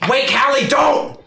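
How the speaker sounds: high-pitched, frightened shout; desperate yell. Acted. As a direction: cry out in desperation and fear. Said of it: "and he yells".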